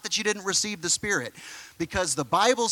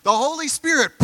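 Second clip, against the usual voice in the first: rising, louder burst on the Holy Spirit; sudden swell of excitement; dramatic build; 6 semitones higher, 4 dB louder than usual. Acted. Dramatic moment in the story.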